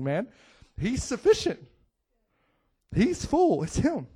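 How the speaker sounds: fired-up preacher proclaiming; preaching at full force. Real, not acted. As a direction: drive every line home. Preacher builds again.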